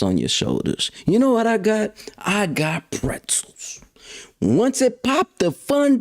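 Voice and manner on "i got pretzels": whispered punchline. Acted. Punchline whispered after question.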